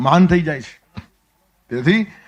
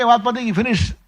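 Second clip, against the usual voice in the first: raised pitch, fed up and done; a god cutting the argument short, higher pitched; exasperated Krishna; 6 semitones higher than usual. Retold or imitated. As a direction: close the matter like an impatient god. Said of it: "ભગવાન કે".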